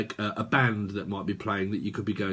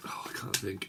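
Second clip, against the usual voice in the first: ordinary talk, nothing marked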